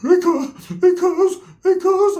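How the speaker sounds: high whining mock cry of a scared kid; crying friend imitation. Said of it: "He's crying".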